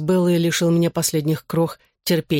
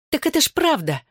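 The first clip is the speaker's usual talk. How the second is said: much higher female voice, snapping; barked retort; 11 semitones higher than usual. A narrator's reading. Bella defends her insults to her ex, barking. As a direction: snap back, pitched well up. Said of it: "рявкнула Белла".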